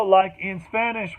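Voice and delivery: usual speaking voice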